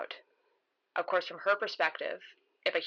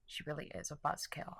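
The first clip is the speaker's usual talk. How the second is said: much softer private grumble; quiet aside; 9 dB quieter than usual. Acted. Narrator privately grumbling about her companion, quiet aside. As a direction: say it softly, as if to yourself.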